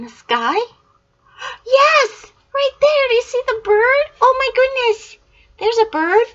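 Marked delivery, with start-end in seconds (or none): 1.6-6.3 high, loud cheering; excited shout. Real, not acted. Grandma cheering as the child finds the bird. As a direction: burst out with joy.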